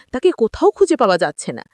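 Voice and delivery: ordinary delivery, nothing marked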